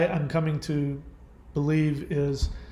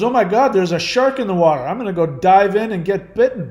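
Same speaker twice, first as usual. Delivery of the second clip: loud, hammed-up daredevil announcement; mock excitement; 4 semitones higher, 7 dB louder than usual. Host mimicking a man leaping at a shark, mock-excited. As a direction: blurt it out like an eager fool.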